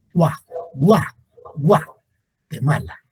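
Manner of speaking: "Gua" as one syllable, repeated, then slow "Guatemala"; fused diphthong demo. Shown as a demonstration. Teacher showing the vowels fuse, diphthong drill on "Gua". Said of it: "Se fusionan".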